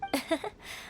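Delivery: brief, soft embarrassed chuckle; embarrassed soft laugh. Acted. Ganyu laughing at her own misjudgment, a soft embarrassed chuckle. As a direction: laugh it off, a little abashed.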